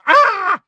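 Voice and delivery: very high, loud startled shriek; startled scream. A narrator's reading. Hermione shrieking as the twins appear, startled. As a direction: cry out in sudden fright.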